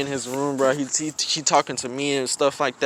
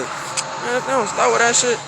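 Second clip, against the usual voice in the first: frustrated mutter; 8 semitones higher than usual. Retold or imitated. Retold frustrated thought.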